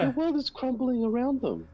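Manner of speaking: very high, hammed-up lament; mock tearful. Co-host mocking the mainstream media's collapse, mock tearful. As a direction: wail it in put-on despair. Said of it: "just trying to hold back the tears".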